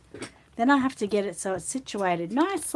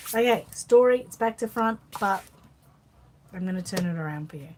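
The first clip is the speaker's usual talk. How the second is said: normal talking voice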